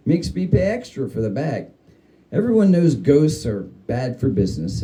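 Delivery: ordinary delivery, nothing marked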